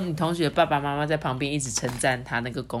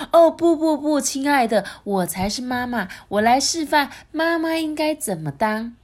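high, louder at first, taking over; bossy queen; 8 semitones higher, 3 dB louder than usual. Acted. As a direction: butt in to be the mother. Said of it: "皇后就说".

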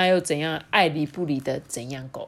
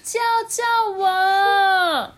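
high, drawn-out cry, much higher and louder; princess crying for help; 13 semitones higher, 5 dB louder, much slower than usual. Acted. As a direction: wail for rescue from the tower. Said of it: "她就大叫着说". (公主拉长声音高喊救命)

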